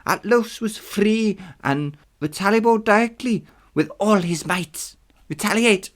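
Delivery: high, loud declaimed reading; high character voice. Acted. Reader voicing the ancient rules for immortals, high declaiming.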